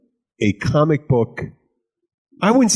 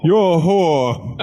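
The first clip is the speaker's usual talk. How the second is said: slow, higher-pitched mock British accent; British lord; 7 semitones higher, much slower than usual. Joking as a British lord, drawn-out posh British accent. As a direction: drag it out like a hammy British lord. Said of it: "you sound like a British lord".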